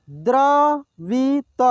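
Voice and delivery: द्रवित drawn out slowly, syllable by syllable; slow sounding out. Shown as a demonstration. Teacher slowly sounds out द्रवित for students. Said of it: "एक एक मात्रा को अलग अलग करके".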